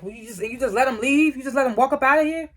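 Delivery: high, rising, incredulous questioning; retold disbelief. Rollins challenging his brother for letting someone leave, incredulous.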